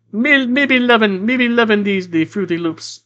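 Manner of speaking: lightly voiced Jamaican cinnamon stick mascot; Jamaican accent. Player tries Jamaican accent for Calypso's mental voice. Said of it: "That's what my Jamaican accent defaults to".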